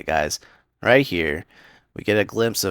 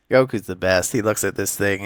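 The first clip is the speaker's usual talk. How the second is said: ordinary delivery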